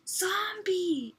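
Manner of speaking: put-on high excited yell of a child; kid yelling Zombie. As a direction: shout it like a gleeful kid. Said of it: "he would say".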